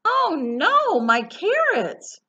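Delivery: high, loud storybook wail; rabbit's acted, drawn-out lament; dismayed cry. A narrator's reading. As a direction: cry out over a precious loss. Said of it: "cried Rabbit".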